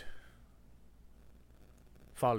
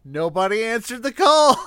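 high, loud mock-epic proclamation; mock dramatic shout; 13 semitones higher, 18 dB louder than usual. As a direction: bellow it like a silly hero. Player mocks the solemn call.

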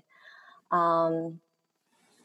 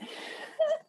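sudden high burst of laughter; real laugh; 4 dB quieter than usual. Real, not acted. Guest caught off guard by the host's praise, high burst of laughter. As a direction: burst out laughing, a little embarrassed.

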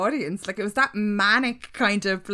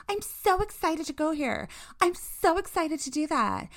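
high, affected fake enthusiasm; mock bland excitement; 9 semitones higher than usual. Mocking people excited about everything, empty high-pitched gush. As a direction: parrot people gushing by rote. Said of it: "saying it in this kind of, like, bland".

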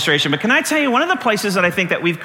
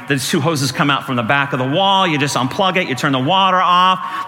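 normal talking voice

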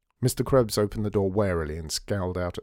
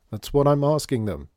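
louder, higher exasperated call; shouting back; 6 semitones higher, 6 dB louder than usual. A narrator's reading. Krebs shouting back at his mother, louder. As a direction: snap it back over the shoulder.

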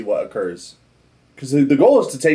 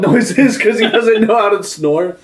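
laughing; 9 semitones higher, 7 dB louder, slower than usual. Real, not acted. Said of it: "can I hear Copian snore sounds".

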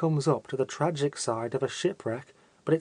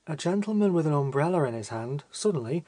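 plain reading voice

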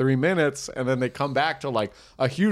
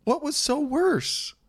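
very high, drawn-out question; slow, squeaky-high mock probing; high-pitched questioning; 14 semitones higher, much slower than usual. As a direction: stretch it out high in mock concern. Probing the partner in a bit.

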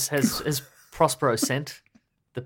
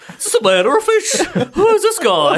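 very high, loud hammed-up clowning; jester always on; 14 semitones higher, 9 dB louder than usual. Mocking a jester who is always on, shrill shouted clowning. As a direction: shriek it like an over-the-top comic. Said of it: "they're always bloody on".

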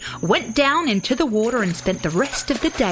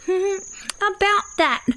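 higher, sheepish koala voice; nervous hedging; 12 semitones higher than usual. A narrator's reading. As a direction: begin awkwardly with a nervous hum. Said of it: "chuckled nervously".

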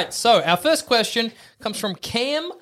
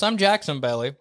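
ordinary delivery

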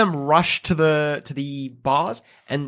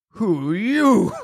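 slow, put-on orc challenge; 4 semitones higher, slower than usual. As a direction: drag it out as a lumbering orc. Game master voices surprised orc.

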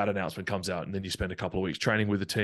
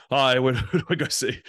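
awkward laugh; 5 semitones higher, 7 dB louder than usual. Retold or imitated. Rocking up at the hotel.

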